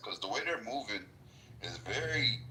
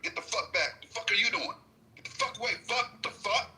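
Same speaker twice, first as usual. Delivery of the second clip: higher mock yelling, string of curses; imitating crass agents; 11 semitones higher than usual. Describing agents swearing at the public outside, mock yell. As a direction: play a rude agent barking at the crowd. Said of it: "they weren't speaking like a public servant".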